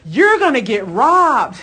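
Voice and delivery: loud, slow, mock-alarmed warning; friend's warning. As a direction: sound the alarm like a worried friend. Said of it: "She goes".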